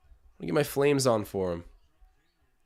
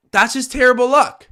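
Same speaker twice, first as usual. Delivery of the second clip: exasperated outcry, higher; 9 semitones higher, 8 dB louder than usual. Real, not acted. Repeats the lament even higher.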